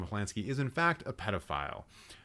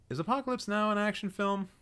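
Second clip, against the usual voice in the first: incredulous question; 10 semitones higher than usual. Real, not acted. Doubts the genre label.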